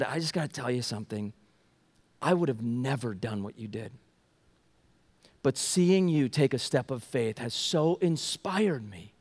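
soft, low, heartfelt retold thanks; choking up. Lady thanks him for his step of faith, softly choked up. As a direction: quietly imitate someone moved and holding back tears. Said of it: "she's choking up here".

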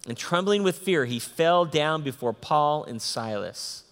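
ordinary talk, nothing marked

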